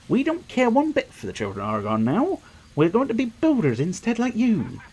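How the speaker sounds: high, swooping farmer voice; eager timid farmer. Acted. Farmer tells Mister Feldon they changed their minds, high eager voice. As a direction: pipe up apologetically in a high put-on voice.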